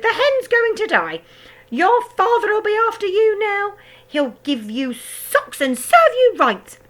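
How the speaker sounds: high, loud crone voice; gloating grandma. A narrator's reading. Grandma taunting George about his father. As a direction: crow over the boy's trouble. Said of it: "Grandma shouted".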